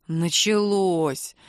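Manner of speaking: very slow, lightly voiced long-suffering groan; weary sigh. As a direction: drag it out, resigned. Max's weary thought.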